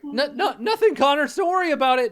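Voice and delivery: high, loud, fussy reassurance; high-pitched, hurried character voice; skeleton illusionist. Acted. Game master voices Fezzik.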